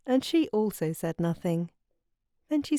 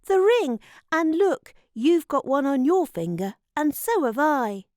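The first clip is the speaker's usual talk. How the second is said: put-on louder, higher, excited boy; excited cry; 6 semitones higher, 5 dB louder than usual. A narrator's reading. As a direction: exclaim at a sudden discovery. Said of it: "cried Diggory".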